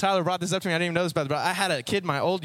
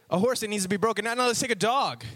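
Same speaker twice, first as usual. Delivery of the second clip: ordinary talk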